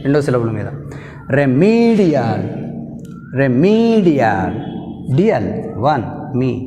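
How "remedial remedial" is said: Remedial, slow, stress on me; stress demo. Shown as a demonstration. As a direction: stretch Remedial, hit me. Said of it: "Take stress on the medial". (మీ మీద ఒత్తిడి, నెమ్మదిగా)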